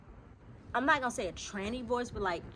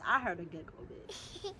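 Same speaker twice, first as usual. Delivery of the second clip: ordinary delivery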